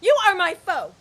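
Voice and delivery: loud, high, tearful shouting; furious shout. Acted. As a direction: order him out, near tears. Diana throws Tom out.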